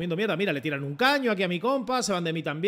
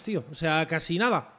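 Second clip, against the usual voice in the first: ordinary delivery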